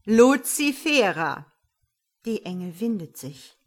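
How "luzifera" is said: loud, slow, thundering name call; drawn-out, stern shout, falling; anything but gentle. Acted. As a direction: bellow her name in reproof. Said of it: "alles andere als sanft".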